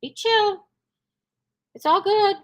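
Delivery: high, light soothing to the dogs. Retold or imitated. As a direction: coax them to relax. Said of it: "I just kinda talked".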